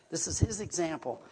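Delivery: normal talking voice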